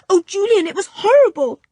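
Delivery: put-on high, loud, shaken boy; frightened. A narrator's reading. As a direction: blurt it out, still scared. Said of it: "clutched Julian's arm".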